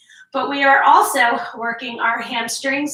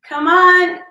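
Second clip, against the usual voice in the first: louder, higher push to keep going; urging shout; 5 semitones higher, 5 dB louder than usual. Real, not acted. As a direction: egg them on through the last reps. Coach urging final reps.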